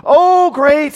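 very high, loud pompous cry; pompous young man. Acted. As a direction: still grand while catching breath. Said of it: "this kind of pompous numbskull".

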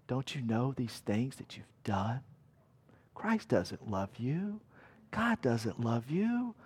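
soft accusing whisper; nagging inner voice. Retold or imitated. A nagging inner voice accusing the listener, whispered and soft. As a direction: hiss the doubts into their ear. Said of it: "this nagging voice comes to you".

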